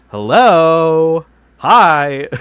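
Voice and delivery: loud, high, shouted hello; mock enthusiastic yell; over-the-top greeting. Shown as a demonstration. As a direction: burst in like a hyper host. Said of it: "that's not it".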